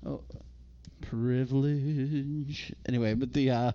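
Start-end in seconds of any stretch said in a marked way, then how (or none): 1.0-2.7 privilege, stretched out very slowly; mock drawn-out. Co-host reacting to the redhead hair minority line, mock drawn-out. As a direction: drag the word out mockingly.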